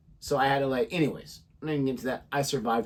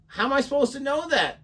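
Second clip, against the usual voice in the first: high-pitched retold indignation; exasperated, pitched-up comeback; indignant protest; 9 semitones higher than usual. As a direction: reenact pleading innocence to a cop. Retells arguing with the cop.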